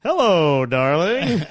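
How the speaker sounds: loud, lilting husband's hello; hammed-up loving phone hello; mock sweet greeting. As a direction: greet your wife with exaggerated sweetness. Unsuspecting dad greets his wife.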